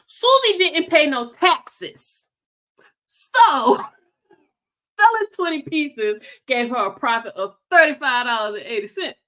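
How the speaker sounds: Tiffany's accent attempt. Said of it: "I'm gonna read this how I feel like Tiffany said it".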